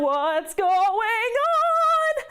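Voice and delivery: high, drawn-out, sing-song wail; panicked cry. Acted. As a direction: stretch it out like a startled man swept into the sky.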